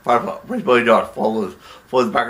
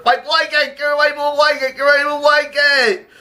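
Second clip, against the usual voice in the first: very high, frantic wail; panicked shriek; 12 semitones higher than usual. Acted. Nervous accountant wanting his blanket back, panicked shriek.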